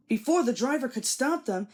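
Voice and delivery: ordinary delivery, nothing marked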